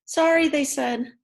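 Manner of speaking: parents' apology. Acted. Parents refuse Henry.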